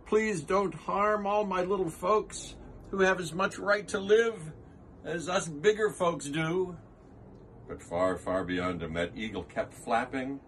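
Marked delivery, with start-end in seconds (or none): 0.0-6.8 higher, pleading elephant; begging. Acted. Horton begging the eagle, higher pleading. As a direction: beg for the little folks. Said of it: "and begged".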